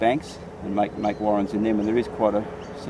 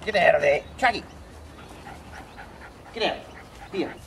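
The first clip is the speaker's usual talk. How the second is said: calling the dog; 8 semitones higher than usual. Real, not acted. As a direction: yell it to get the dog out of the burrow.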